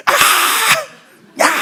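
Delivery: short, sharp bawling cries; mock toddler outburst in two bursts; imitated child's wail. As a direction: mimic a small child shrieking. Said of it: "소리쳐 울어요".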